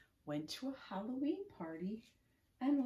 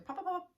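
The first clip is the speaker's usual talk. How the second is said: faster popping sounds; 11 semitones higher, faster than usual. Shown as a demonstration. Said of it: "A little faster".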